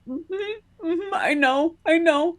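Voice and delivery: high, tearful, strained agreement; choked crying. Retold or imitated. Agreeing to her helpers while holding back tears, high and choked. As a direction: nod along while fighting tears. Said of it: "I'm sitting there going".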